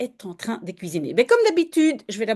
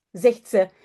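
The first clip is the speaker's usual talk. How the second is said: ordinary delivery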